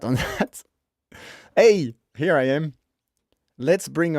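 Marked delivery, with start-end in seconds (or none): none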